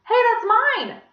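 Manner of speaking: high, loud indignant cry; protesting yell. A narrator's reading. Girl protesting that the ruler is hers, loud and high. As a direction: claim your ruler back.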